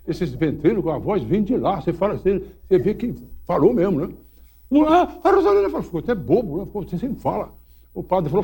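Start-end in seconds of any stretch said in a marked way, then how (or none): 4.9-5.8 espanto do menino. Retold or imitated. Menino espantado com a mula.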